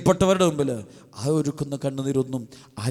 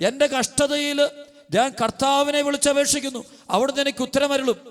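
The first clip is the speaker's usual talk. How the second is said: high, ringing sermon proclamation; proclaimed verse; 11 semitones higher than usual. Acted. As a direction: cry the verse out to the congregation. (ഉയർന്ന സ്വരത്തിൽ വചനം ഘോഷിക്കുന്നു)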